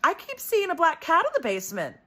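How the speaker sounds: high, childlike imitation of a seven-year-old; little sister's voice. Kid sister at dinner table, high childlike imitation. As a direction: pitch it way up like a small girl blurting it out. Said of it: "she goes".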